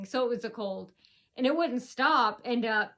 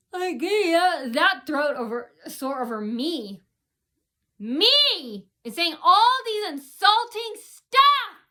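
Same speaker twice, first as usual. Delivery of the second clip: imitated rant, much louder and higher; mock rant; 7 semitones higher, 9 dB louder, slower than usual. Coworkers insulting her all shift, imitated as a loud high rant. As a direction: belt it out like the ranting coworkers.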